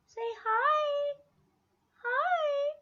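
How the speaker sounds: very high squeaky pet voice; high baby talk. Acted. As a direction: speak for the dog, up high. Voicing the dog.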